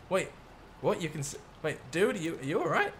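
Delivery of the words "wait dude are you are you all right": ordinary delivery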